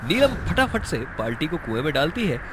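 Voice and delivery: plain reading voice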